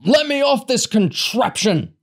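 high, loud, outraged roar; bellowing. A narrator's reading. Big man demanding off the stretcher, bellowing. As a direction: bellow to be let go. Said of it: "Large bellowed".

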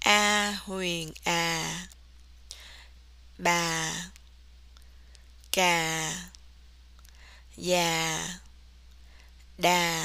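"a, ba, ga, ya, da" drawn out low, sinking slightly; slow, low down tone on "A huyền a, ba, ga, ya, da"; low falling tone. Shown as a demonstration. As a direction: start low and stay low on "a, ba, ga, ya, da". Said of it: "You start low and you stay low".

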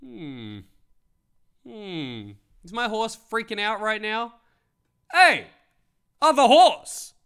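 hums idly, then yells high and loud; a dense owner who finally shouts at the wrong horse; oblivious horse owner. Retold or imitated. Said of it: "this horse owner's like".

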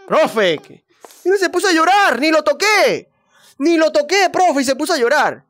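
put-on high, loud whining kid; whiny protest. Kid to teacher denying he hit anyone, high and whiny. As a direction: plead innocence to the teacher.

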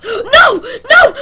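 high laughing shout of no; shocked shouting. Real, not acted. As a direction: yell it in shocked disbelief. Money suddenly lost in game.

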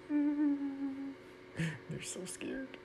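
breathy gleeful whisper, slow. Acted. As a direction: hush it out slowly with glee. Gleeful aside about the seeds.

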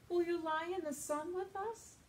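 cat's invitation. Acted. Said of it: "said Cat".

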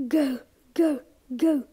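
ordinary delivery

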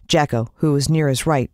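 plain reading voice

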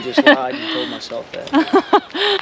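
high, giggly self-mocking admission; laughing confession. Real, not acted. Husband admits to his wife he fooled himself, laughing.

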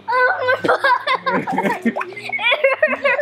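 long high-pitched laugh; high laughter. Real, not acted. Kids slipping in slime.

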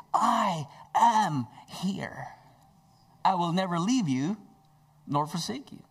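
Jesus assuring frightened disciples, emphatic; emphatic reassurance. Acted. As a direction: promise it, stretching the words. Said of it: "he had the emphasis that".

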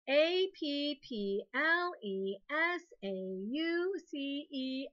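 slow, letter by letter; spelling aloud. Shown as a demonstration. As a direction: clap out each letter slowly. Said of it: "clap a syllable".

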